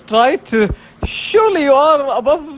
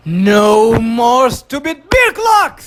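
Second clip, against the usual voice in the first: slow, drawn-out triumphant yell; triumphant shout; 3 dB louder, slower than usual. Acted. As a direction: bellow it out in victory. Climax of gloating rant.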